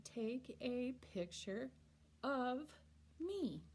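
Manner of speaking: slow, with of said fully and apart; each word separated, of spoken in full; careful written form. Shown as a demonstration. Teacher reading the written form, slow and careful. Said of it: "we write".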